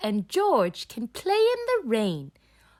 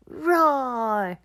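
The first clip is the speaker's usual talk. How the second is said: put-on a toddler's playful roar; pretend dinosaur roar; 4 semitones higher, 4 dB louder than usual. George roaring again.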